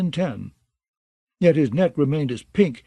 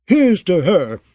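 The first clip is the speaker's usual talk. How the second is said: loud, boisterous toast, pitched up; drunken toast; 4 semitones higher, 6 dB louder, slower than usual. A narrator's reading. Molander toasts the lost princess with a loud, rowdy cheer. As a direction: raise the tankard and shout it out.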